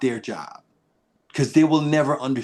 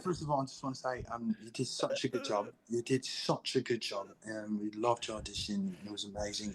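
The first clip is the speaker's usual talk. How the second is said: much softer, measured quoted voice; soft-spoken praise; 2 semitones lower, 14 dB quieter than usual. Retold or imitated. Director complimenting an actor on his audition, soft-spoken.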